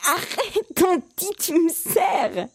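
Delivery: put-on high, squeezed child's giggly plea; laughing protest. A narrator's reading. Boy squeezed in aunt's hug, laughing protest. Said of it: "dit Ange-Marie en riant".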